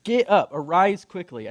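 punchy loud command to get moving; brisk wake-up call. Acted. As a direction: rouse a sleeper briskly. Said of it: "a nice way of saying".

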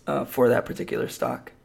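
usual speaking voice

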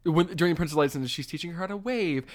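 usual speaking voice

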